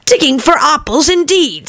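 high, scornful retort; angry scoffing. A narrator's reading. The Rabbit scolding Pat, high and scornful. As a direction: scoff at the servant's answer. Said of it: "said the rabbit angrily".

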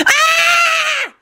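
very high screamed cry; short piercing scream; screech. A narrator's reading. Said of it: "screeched at the top of her lungs".

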